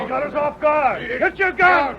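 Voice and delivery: high, strained yells mid-scuffle; frantic high shouts; urgent shouting. Acted. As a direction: bark the orders in panic. Struggle, shouting orders.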